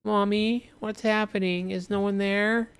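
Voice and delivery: put-on high small child's voice; little child. Young boy outside asking his mother, high child voice. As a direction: ask like a scared little kid. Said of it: "And the child is outside".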